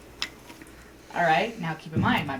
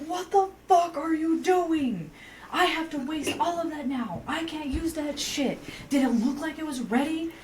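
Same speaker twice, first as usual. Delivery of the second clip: a much higher, strained voice that keeps going off at him; retold furious rant; 10 semitones higher than usual. Worker reenacting yelling at a customer who contaminated the food, mock furious. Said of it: "Like, I am going off".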